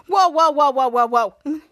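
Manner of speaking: loud run of whoas, falling in pitch; retold wobbly "whoa". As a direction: imitate a man losing his balance. Retold man leaning over.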